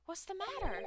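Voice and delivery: lightly voiced high girl; a hint of a worried assistant; character voice.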